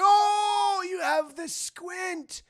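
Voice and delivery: put-on high, very slow old rat voice; drawn-out doddery pirate; ancient cabin boy. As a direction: reminisce like a doddering old sailor. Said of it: "He's like".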